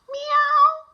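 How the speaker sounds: a high, drawn-out meow; high meow from a tree; cat meow. Acted. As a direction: call down like a barn cat.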